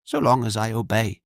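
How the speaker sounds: lightly voiced young woman, dry and wry; a hint of a bitter maid, understated. The girl tells Jorian how her master treats her. Girl's voice in dialogue.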